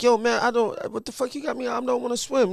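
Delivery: put-on high-pitched complaining young guy; whiny dude. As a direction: protest the early beach trip.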